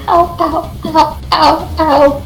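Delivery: mock whimpering. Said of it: "she's just kinda like".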